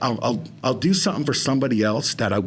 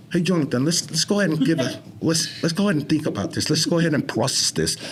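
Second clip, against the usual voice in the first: higher, put-on British self-coaching; British accent; 3 semitones higher than usual. Shown as a demonstration. Man coaching himself through his therapy, British accent. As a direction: talk to yourself as a British bloke. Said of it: "when I'm playing a different role".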